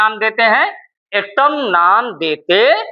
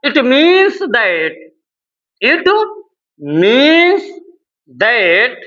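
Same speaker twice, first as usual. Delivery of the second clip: word-by-word dictation with long pauses; slow dictation; much slower than usual. Real, not acted. Teacher dictating notes to students, slow dictation. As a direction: draw each word out for note-takers.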